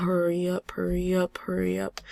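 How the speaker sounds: whispered urging. Real, not acted. Player whispers at the lagging game, urging it on.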